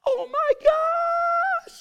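high, slow awestruck squeal; starstruck fangirl gasp. Retold or imitated. Woman reaching heaven, gasping at Jesus in awe. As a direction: gasp it out like a fangirl meeting her idol. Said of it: "just like".

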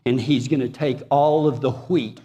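ordinary delivery

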